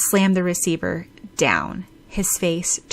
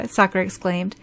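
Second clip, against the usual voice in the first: ordinary delivery, nothing marked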